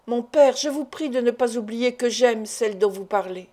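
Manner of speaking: plain reading voice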